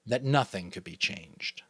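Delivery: usual speaking voice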